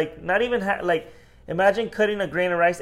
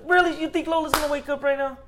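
high, loud worked-up telling; too loud; 12 semitones higher than usual. Real, not acted. Man retelling the story loudly while baby sleeps. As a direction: get carried away loud. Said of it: "who are you screaming at".

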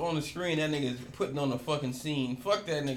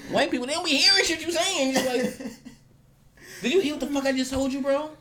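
much higher, louder, fast mock complaint; mock exasperated; 9 semitones higher, 5 dB louder than usual. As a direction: squeal it out in mock frustration. Imitating exasperated complaint to cashier.

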